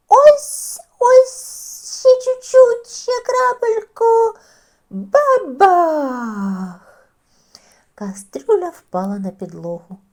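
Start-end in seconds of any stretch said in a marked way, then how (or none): 0.1-4.4 high, loud, strained reaching voice; straining on tiptoe. A narrator's reading. Мишеня тягнеться з останніх сил. 4.9-6.8 loud bang with a cry; loud crash. A narrator's reading. Кастрюля впала.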